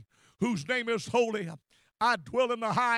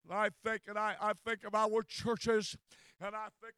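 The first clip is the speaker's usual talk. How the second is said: ordinary delivery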